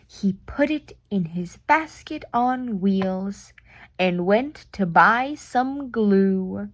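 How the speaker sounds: usual speaking voice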